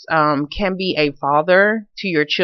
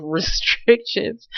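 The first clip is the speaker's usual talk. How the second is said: breaking into a laugh on the word; higher, laughter bursting through the word; real laughing; 6 semitones higher, 3 dB quieter, much slower than usual. Real, not acted. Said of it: "I don't mean to be laughing".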